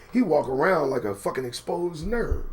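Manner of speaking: usual speaking voice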